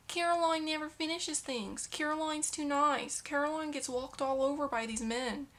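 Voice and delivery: a mock Minnesota accent, slowed down; Minnesotan accent. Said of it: "It was supposed to be Minnesotan".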